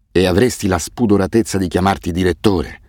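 usual speaking voice

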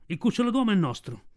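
a touch of a menacing tiger, lightly voiced; tiger's claim. A narrator's reading. Sher Khan tells the wolves, lightly voiced tiger menace. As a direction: lay claim like a tiger at the den. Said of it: "Il ruggito della tigre risuonò come un tuono".

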